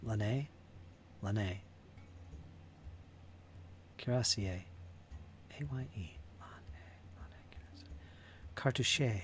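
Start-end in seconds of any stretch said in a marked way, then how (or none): 5.6-7.6 whisper. Real, not acted. Spelling under breath.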